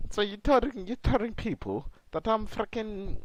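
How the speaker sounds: indignant wrestler in a faked Scottish accent; attempted Scottish accent. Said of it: "I'm trying to do the Scottish accent".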